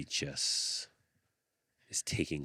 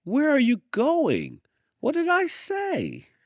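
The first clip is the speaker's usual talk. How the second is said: much higher, louder, slow, clueless; puzzled instructor calling; 12 dB louder, slower than usual. A narrator's reading. Dance instructor calls after the girl who ran off, high and loud. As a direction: call after her, baffled.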